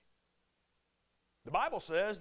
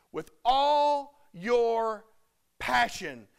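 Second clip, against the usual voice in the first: drawn out, each word weighed; slow it right down and lean on it; a slow, deliberate restatement; slow emphasis; 4 dB louder, much slower than usual. Shown as a demonstration. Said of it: "that word in the original language means".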